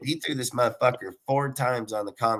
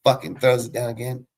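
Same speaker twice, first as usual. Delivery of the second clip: usual speaking voice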